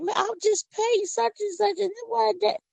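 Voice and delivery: high mock-indignant shopper voice; lightly hammed-up complaint about prices. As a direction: play the baffled shopper at the till. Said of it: "You're like".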